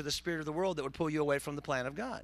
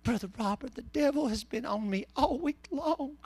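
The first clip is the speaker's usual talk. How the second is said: put-on high-pitched old lady's complaint; Granny King; 8 semitones higher than usual. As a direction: imitate a plaintive elderly churchgoer. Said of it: "this lady named Granny King. She was up in her eighties".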